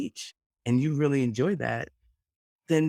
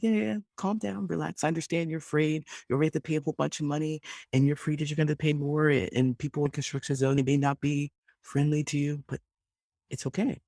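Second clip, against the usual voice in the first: soothing agent; 4 semitones higher than usual. Retold or imitated. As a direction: settle down a panicked caller gently. Agent calming frantic caller.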